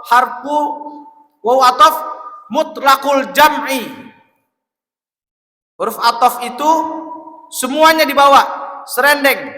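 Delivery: loud, high-pitched teaching from the pulpit; high, ringing lecture to a congregation; forceful preaching. Real, not acted. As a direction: project each phrase loudly, pausing between them. Preaches the lesson at full force.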